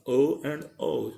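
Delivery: ordinary talk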